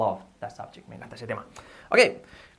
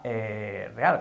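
usual speaking voice

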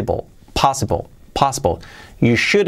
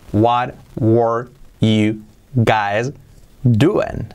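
very slow "What... were... you... guys... doing"; drawn-out dictation pace; word-by-word model; much slower than usual. Shown as a demonstration. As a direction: separate each word with a pause. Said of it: "Así sería".

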